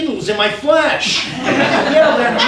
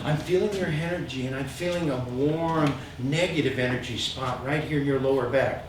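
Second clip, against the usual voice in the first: hushed mystical healer; 7 semitones lower, 10 dB quieter than usual. Retold or imitated. Healer quoted again.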